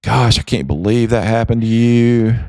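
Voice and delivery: high, cooing mock sympathy; hammed-up pity voice; mock sympathetic friend. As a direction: coo like a yes-man friend. Mocking echo-chamber friend voice.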